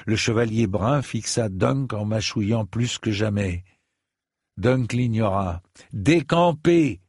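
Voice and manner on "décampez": raised, drawn-out order, short of a roar; firm dismissal, not yet roaring; firm command. A narrator's reading. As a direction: call out the order slowly and higher. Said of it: "mais en rugissant pour le coup".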